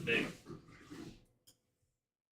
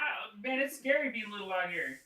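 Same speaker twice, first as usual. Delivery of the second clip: high, chummy little-monster voice; eager, friendly creature chatter; charmed creature; 7 semitones higher than usual. Acted. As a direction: confide in your new best friend, pitched up.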